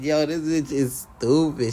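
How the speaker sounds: slow, loud, laughing through the words; half laughing at the absurdity; amused loud drawl; laughing disbelief. Retold or imitated. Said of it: "I said".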